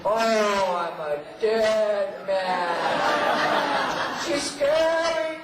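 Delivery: loud, very slow, high mock-terrified wail; mock fright. Co-star mocking her death threat: loud, slow mock fear. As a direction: ham up being scared, drawn out and loud. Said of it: "co-star mocking her threat".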